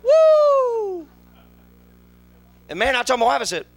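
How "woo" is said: high loud whoop; hammed-up whoop. Real, not acted. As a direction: let out a big whoop. Turning forty exclamation.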